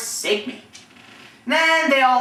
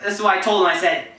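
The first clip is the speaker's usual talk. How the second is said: ordinary delivery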